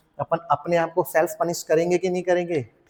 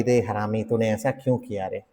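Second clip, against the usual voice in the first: mock self-scolding; 6 semitones lower, 3 dB quieter than usual. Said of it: "हम खुद को डांट रहे हैं कि".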